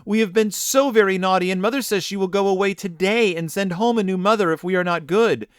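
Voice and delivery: entreating. Acted. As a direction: plead with rising urgency. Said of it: "they entreated again".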